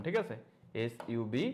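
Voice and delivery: ordinary delivery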